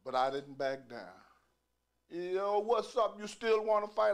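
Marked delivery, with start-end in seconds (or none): 2.1-4.1 higher, louder call-out; tough challenge. Retold or imitated. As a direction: call him out across the room. Challenger calls him out.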